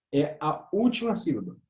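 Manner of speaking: ordinary delivery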